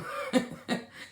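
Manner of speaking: real amused laugh. Real, not acted. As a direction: break into a soft laugh. Laughs at own remark.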